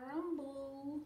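high, drawn-out rolling rumble; voiced hungry-belly noise; loud tummy rumble. A narrator's reading. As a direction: roll the word like a noisy stomach. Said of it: "a very loud noise".

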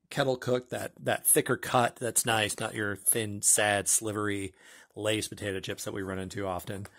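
ordinary talk, nothing marked

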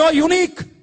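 high, ringing declaration; God declaring. Acted. Preacher voicing God to the congregation, high declaration. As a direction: proclaim it high and emphatically. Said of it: "ദൈവം പറയും".

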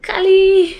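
loud high-pitched call of the name; dog owner calling Kali high and loud. Retold or imitated. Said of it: "I'm like ... high voice".

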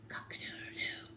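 hushed, voiceless cock-a-doodle-doo; whispered rooster crow. Acted. Rooster who lost his voice tries to wake the farmer, whispering. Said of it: "he's still whispering".

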